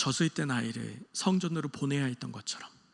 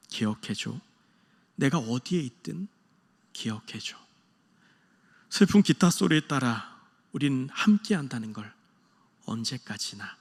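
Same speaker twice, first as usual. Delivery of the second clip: tender farewell lullaby lyrics; 4 semitones lower than usual. Retold or imitated. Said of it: "딸에게 노래를 불러줘요". (이별하는 아빠가 딸에게 부드럽게 읊조리듯)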